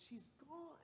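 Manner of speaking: very soft, high, imitated tearful voice; retold sobbing. Granddaughter to pastor at the deathbed, softly retold sob. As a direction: quote her grief in a tiny high hush. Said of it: "she's sobbing".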